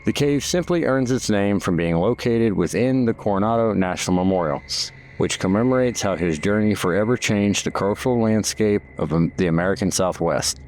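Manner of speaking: measured, informative guided-tour read; documentary narration. Acted. Documentary narration over cave footage.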